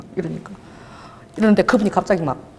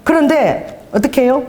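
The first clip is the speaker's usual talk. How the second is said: slow rhetorical question; 4 semitones higher, slower than usual. Real, not acted.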